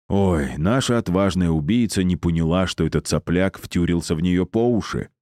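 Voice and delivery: plain reading voice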